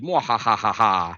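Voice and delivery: slow, hammy evil laugh; evil villain laugh. Acted. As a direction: cackle like a cartoon villain. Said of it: "I hope that's his laugh".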